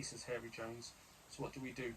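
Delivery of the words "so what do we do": ordinary talk, nothing marked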